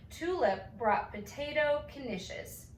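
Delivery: plain reading voice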